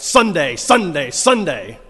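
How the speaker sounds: loud booming announcer chant; monster truck ad. Retold or imitated. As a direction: hype it like a truck rally ad. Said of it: "listening to the commercials on Saturday night".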